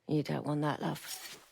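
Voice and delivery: menacing hush. Acted. Villain soothing a captive.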